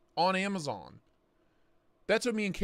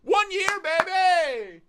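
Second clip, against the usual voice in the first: excited cheer; 13 semitones higher, 6 dB louder, slower than usual. Real, not acted. Celebrating his birthday on stream.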